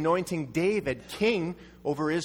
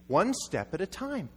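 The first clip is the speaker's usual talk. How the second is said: ordinary talk, nothing marked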